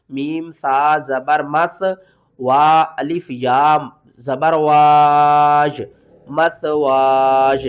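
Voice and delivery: मसवाज with a long held "waa" closing on y; stretched into final y. Shown as a demonstration. Teacher showing the stop, मसवाज drawn out. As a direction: spell slowly and loudly, stretching वाज. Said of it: "खींच कर या के साथ मिलाना है".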